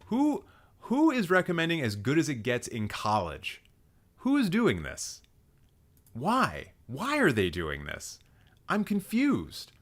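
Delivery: high-pitched incredulous questioning; mock-bewildered, voice jumping up high; baffled. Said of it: "I'm just like".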